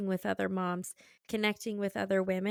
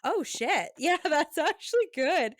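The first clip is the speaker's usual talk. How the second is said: imitated pleased realization; 7 semitones higher, 4 dB louder than usual. Retold husband's pleased realization.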